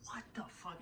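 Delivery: breathless whispered disbelief; stunned whisper. Retold or imitated. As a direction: whisper it, frozen in shock. Stunned reply after crash.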